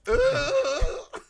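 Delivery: very high, loud mock scream; hammed-up yelp of pain; imitated pain squeal. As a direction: squeal as if your arm is twisted. Imitates cry as arm is twisted.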